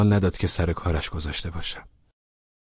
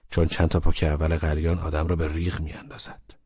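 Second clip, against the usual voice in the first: usual speaking voice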